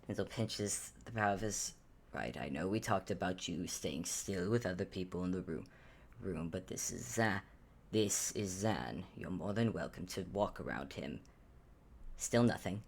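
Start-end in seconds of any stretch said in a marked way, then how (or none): 2.1-11.2 put-on low, softer weary old wizard, coaxing a stubborn pet; mildly exasperated master coaxing; Ansel, dry and patient. Wizard Ansel coaxes his shy cauldron, low and weary.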